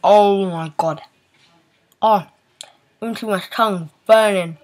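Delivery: eyes watering, strained voice; teary from spicy food. Real, not acted. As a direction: talk through watering eyes and a burning mouth. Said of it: "my eyes are watering".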